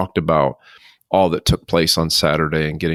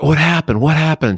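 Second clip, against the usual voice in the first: high, louder alarmed repeat; 9 semitones higher, 3 dB louder than usual. Retold or imitated. Husband bursting in on nurses, high alarmed question. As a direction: demand to know what went wrong. Returning to find nurses hustling.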